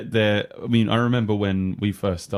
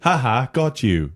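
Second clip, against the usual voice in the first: mock robot gloat; 5 semitones higher, 5 dB louder, slower than usual. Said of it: "I am so funny".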